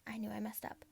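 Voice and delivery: plain reading voice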